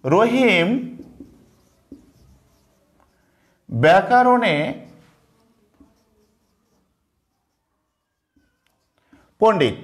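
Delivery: each word set apart by long pauses; slow dictation. Shown as a demonstration. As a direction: dictate it slowly for copying. Dictating second example slowly. (থেমে থেমে শ্রুতলিপি)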